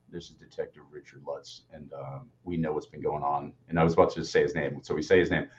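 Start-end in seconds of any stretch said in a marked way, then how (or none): 0.1-3.5 much lower, much softer mock police voice; a quiet, ominous fake cop on the phone; fake detective. Friend posing as detective calls the roommate, ominous low voice. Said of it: "have another friend call up and ask for him and go".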